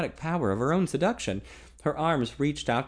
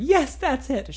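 higher pleading female voice; young woman voice; 14 semitones higher, 5 dB louder, slower than usual. A narrator's reading.

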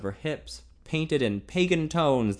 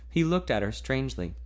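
ordinary delivery, nothing marked

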